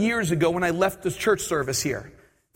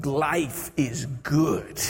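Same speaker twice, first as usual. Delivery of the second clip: slow, drawn-out contentment; contented; 3 semitones lower, much slower than usual. Retold or imitated. Passenger to himself in an empty row, slowly contented. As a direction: savour each word slowly. Savouring his lucky seat, retold.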